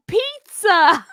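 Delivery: high, loud mock Italian; Italian accent. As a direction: Pizza squealed high in a jokey Italian accent. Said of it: "She's like".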